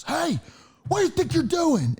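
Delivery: high shrill-pitched mock yell; imitating an angry stranger shouting; bearded man yelling at a kid, mock scream. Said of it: "screaming at me".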